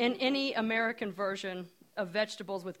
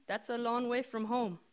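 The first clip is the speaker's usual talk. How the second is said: put-on Indian accent; 3 semitones higher than usual. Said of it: "she says in her strong Indian accent".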